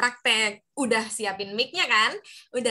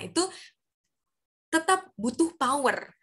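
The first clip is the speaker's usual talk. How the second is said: ordinary delivery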